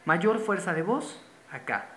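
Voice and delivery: usual speaking voice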